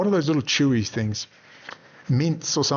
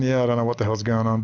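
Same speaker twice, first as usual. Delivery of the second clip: usual speaking voice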